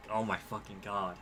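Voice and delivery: ordinary talk, nothing marked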